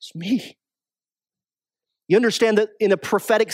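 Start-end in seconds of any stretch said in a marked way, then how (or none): 0.0-0.6 despairing woe. Retold or imitated. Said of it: "His response was".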